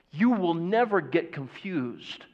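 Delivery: ordinary delivery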